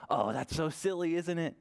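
usual speaking voice

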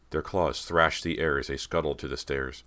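ordinary delivery

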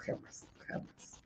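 "jamás, jamás" casi susurrado y lento; susurro enfático. Real, not acted. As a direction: insistencia sin voz en "jamás, jamás". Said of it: "borracho jamás". ("jamás, jamás" en susurro lento)

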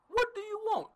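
ordinary delivery

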